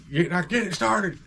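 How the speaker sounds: high mock holler; imitating yelling dad. Dad ordering kid to start bike, mock yell.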